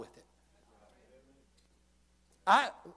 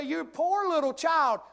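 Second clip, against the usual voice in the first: mock pity; 10 semitones higher, 4 dB louder, slower than usual. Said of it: "You say".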